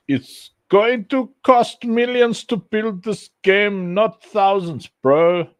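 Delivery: high, loud, slow mock complaint; mocking the naysayers. Streamer quoting doubters on game budgets, high mocking voice. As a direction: put on a high, forceful naysayer voice. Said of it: "People like".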